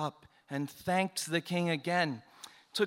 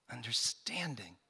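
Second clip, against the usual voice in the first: whisper; 6 semitones lower, 7 dB quieter than usual. A narrator's reading. King's praise fades out.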